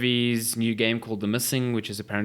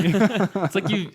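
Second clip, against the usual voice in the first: laughing; 9 semitones higher, 4 dB louder, much slower than usual. Real, not acted. Laughing at silly name.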